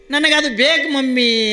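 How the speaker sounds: put-on whiny crying child. A narrator's reading. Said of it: "ಅತ್ತು".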